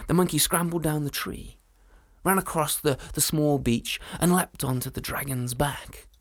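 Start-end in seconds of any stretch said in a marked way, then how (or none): none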